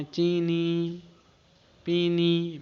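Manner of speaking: चीनी and पीनी with both long ee vowels drawn out; teacher demonstrating the long ee in चीनी and पीनी. Shown as a demonstration. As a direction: stretch each ee slowly in चीनी, पीनी. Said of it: "दोनों में बड़ी मात्रा".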